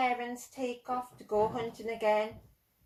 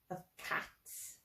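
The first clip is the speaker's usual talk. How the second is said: whisper; 13 dB quieter than usual. Acted. Unvoiced word in cat's line.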